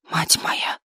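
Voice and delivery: soft voiceless whisper; hushed whispered exclamation. A narrator's reading. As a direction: whisper it in shock. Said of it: "прошептал Костецкий".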